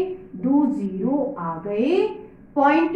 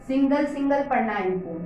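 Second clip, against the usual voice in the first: ordinary delivery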